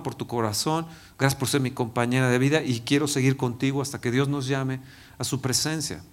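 soft, low, loving words to his wife; tender farewell. Retold or imitated. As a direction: hush it down and say goodbye with love. Farewell words to wife before surgery.

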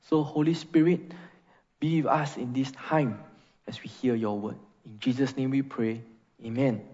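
quiet closing prayer after the reading. Acted. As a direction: pray gently over the congregation. Leads a congregational prayer.

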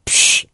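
loud hissed "psh" of a beer opening; beer-can crack. Retold or imitated. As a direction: imitate a can cracking open. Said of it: "cracks a beer".

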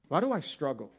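normal talking voice

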